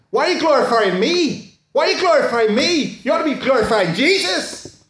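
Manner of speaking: loud, high Northern Irish rebuke; Northern Irish accent. Retold or imitated. Elderly lady rebuking the applauding mission, loud Northern Irish accent. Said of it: "in her Northern Ireland accent".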